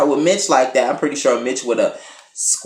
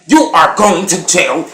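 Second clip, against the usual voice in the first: louder yell with a higher pitch; shouted threat; 6 semitones higher, 8 dB louder than usual. Retold or imitated. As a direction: yell it after the fleeing truck. Guy yelling after car is run over.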